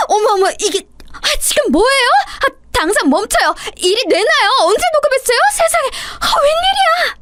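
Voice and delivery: panicked shriek. Acted. Caught daughter-in-law shrieking as her recording plays, high panicked cries. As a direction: shriek like you've been caught red-handed.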